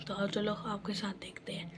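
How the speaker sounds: hushed whisper to the viewers; soft whispered aside. Real, not acted. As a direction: drop to a whisper.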